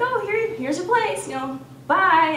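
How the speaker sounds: high, bright, breezy send-off; cheery goodbye. Retold or imitated. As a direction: sing it out like you're glad it's done. Dropping him off at the dorm.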